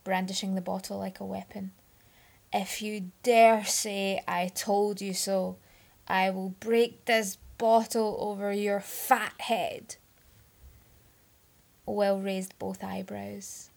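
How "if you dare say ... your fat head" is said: put-on loud, slow drunken menace; slurred loud menacing threat. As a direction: threaten him with the bottle, slowly. Drunk Merlin threatens Will.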